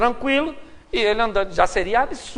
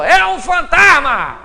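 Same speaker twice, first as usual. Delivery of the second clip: drawn-out frightened cry; mock scream; 6 semitones higher, 6 dB louder, much slower than usual. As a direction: yell it like a scared sailor. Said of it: "Alguém gritou". (grito de susto imitado)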